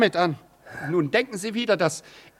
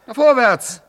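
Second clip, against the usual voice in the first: loud commanding shout; barking orders; 4 semitones higher, 8 dB louder than usual. Acted. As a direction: drive him on with loud orders. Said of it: "wie reden Sie denn mit mir".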